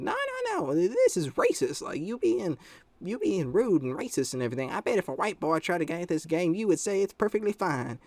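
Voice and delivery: high, swooping mock-outraged mother; imitated angry mom. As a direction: protest as an offended mom, pitched well up. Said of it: "she was all just mad".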